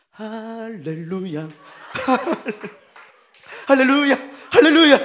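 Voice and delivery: relieved older brother shouting hallelujah in mock praise; joyful hallelujah. Praise bursting out, laughing.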